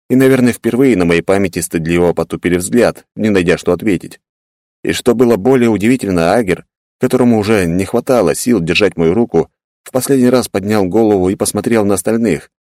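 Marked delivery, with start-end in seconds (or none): none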